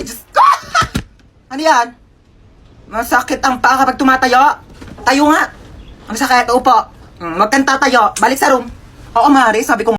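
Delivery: ordinary talk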